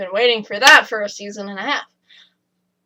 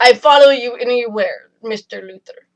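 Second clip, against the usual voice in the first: put-on light Russian accent, devoted; a hint of a Russian accent, loyal; 6 dB louder than usual. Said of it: "That's a pretty good Russian accent".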